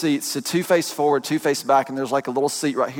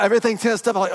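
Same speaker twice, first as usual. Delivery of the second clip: ordinary talk, nothing marked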